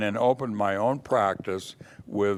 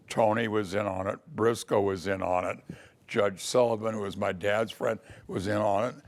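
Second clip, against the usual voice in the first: normal talking voice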